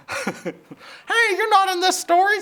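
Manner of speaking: very high squawky hen voice, breaking into laughter; chicken voice, laughing. Acted. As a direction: redo it as the hen, giggling. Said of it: "said Chicken Licken".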